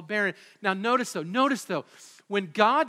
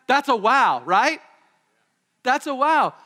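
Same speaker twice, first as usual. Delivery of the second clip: loud, high cry of wonder; excited exclaim; 6 semitones higher, 10 dB louder than usual. Acted. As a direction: shout the wow to the room.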